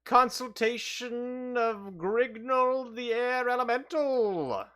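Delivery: high, slow, grand spirit voice; air elemental voice. Acted. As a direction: speak like a lofty air spirit. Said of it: "says the air elemental".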